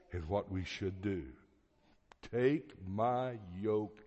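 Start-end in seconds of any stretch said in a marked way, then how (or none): 2.3-4.0 slow, measured scripture quote; each word spaced out with weight; solemn command. Retold or imitated. Preacher quotes Jesus to the congregation, slow solemn command. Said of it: "Jesus commanded".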